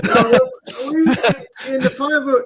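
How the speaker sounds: "No" burst out loud and high through laughter; laughing. Real, not acted. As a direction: break into the word mid-laugh. Laughing while starting to speak.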